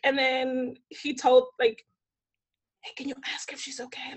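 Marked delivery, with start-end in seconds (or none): 2.8-4.1 imitated hushed aside; discreet whisper. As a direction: whisper it privately to a colleague. Photographer's discreet aside to someone.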